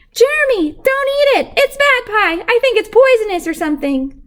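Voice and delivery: high, loud frantic warning; boy yells at his friend not to eat the pie; panicked shout. A narrator's reading. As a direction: yell to stop him just in time.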